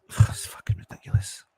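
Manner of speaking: sighing, whispered disgust. Real, not acted. Fan whispering to himself after the goal, disgusted. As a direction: breathe it out under your breath.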